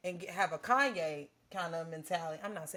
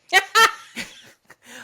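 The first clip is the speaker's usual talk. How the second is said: big high laugh bursting out; a big loud laugh; loud burst of laughter; 12 semitones higher, 17 dB louder than usual. Real, not acted. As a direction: let a loud laugh explode. Big laugh mid story.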